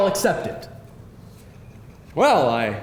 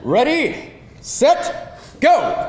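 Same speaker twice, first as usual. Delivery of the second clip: countdown call; 6 semitones higher, slower than usual. Acted. Countdown start of quiz.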